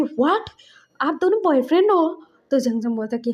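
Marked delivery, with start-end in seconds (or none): 0.0-2.1 higher, louder shocked question; surprised. Retold or imitated. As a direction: blurt it out in disbelief. Learns brothers are boyfriends.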